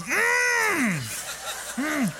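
very high, shouted, drawn-out hum of disapproval; imitating a piercing high disapproving hum; high mock hum. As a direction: shriek a long high warning hum. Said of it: "She goes".

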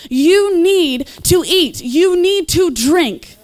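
slow, loud, emphatic plea; urgent plea. Real, not acted. Preacher urging listeners to read the Bible, slow and loud. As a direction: press each word home to the crowd.

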